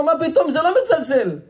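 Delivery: very high-pitched disbelieving protest; heated denial. Acted. Old man argues back.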